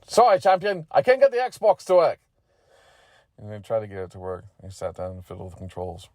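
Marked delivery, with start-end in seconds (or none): 0.1-2.2 high, loud, exaggerated complaint; loud character voice. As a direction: call it out big in a high voice. Repeats the line loudly in borrowed voice.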